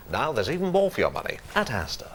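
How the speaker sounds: BBC-type advert read; smooth, straight commercial delivery; smooth straight voiceover. Shown as a demonstration. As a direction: glide through it like a polished announcer. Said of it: "it was sort of smooth".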